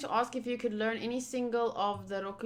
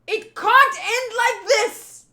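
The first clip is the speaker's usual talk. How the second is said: high, loud, slow protest; impassioned, drawn-out cry; anguished outcry; 9 semitones higher, 10 dB louder, slower than usual. Acted. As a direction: shout it with each word leaned on. Outraged at the duel's outcome.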